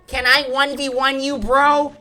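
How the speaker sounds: high, loud gamer begging; mock pestering player. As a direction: imitate an eager kid challenging someone. Imitates players begging for a match.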